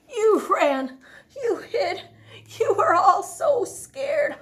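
laughing taunt; teasing. Acted. Bonehead teasing his scared friends, laughing. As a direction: tease them with a laugh. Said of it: "Bonehead declared".

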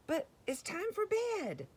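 high-pitched, whiny dad protest; dismayed daddy. Acted. Reader voices Daddy at bedtime, high and dismayed. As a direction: plead it in a high, squeaky dad voice. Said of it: "Look at Daddy going".

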